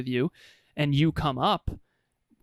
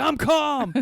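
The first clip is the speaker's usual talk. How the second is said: sudden yell, much higher and louder; explosive furious yell; angry shout; 12 semitones higher, 5 dB louder than usual. Acted. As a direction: blow up shouting the word calm. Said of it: "He's like".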